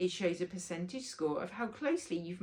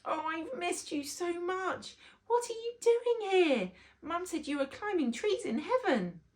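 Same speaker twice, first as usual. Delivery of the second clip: put-on a little boy gushing at his lost grandad; young boy overjoyed; 12 semitones higher, 5 dB louder than usual. Rory greeting his grandad the bee, high eager child voice.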